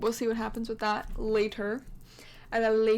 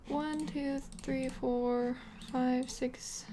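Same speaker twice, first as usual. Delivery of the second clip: ordinary talk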